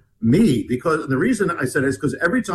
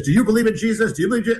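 higher-pitched imitation of an agitated questioner; retold urgent questioning, raised pitch; mock challenge; 6 semitones higher, much faster than usual. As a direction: pitch the repeated question up, imitating someone accosting strangers. Retold accosting of passengers.

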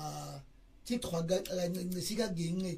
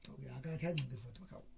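ordinary talk

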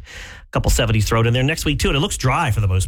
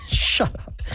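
whispered; 4 dB quieter than usual. Real, not acted. Joking aside after cough.

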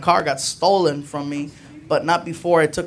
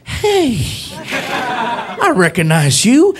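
cheery cop; 7 semitones higher, slower than usual. Retold or imitated. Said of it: "He's like".

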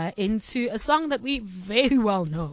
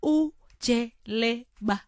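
slow pronunciation; much slower than usual. Real, not acted. Radio host carefully saying an unfamiliar song title, slowly. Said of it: "I have to say it slow".

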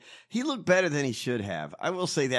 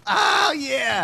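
loud, high cheer; 10 semitones higher, 6 dB louder than usual. Real, not acted. Cohost cheers on the host's news, cheering loudly.

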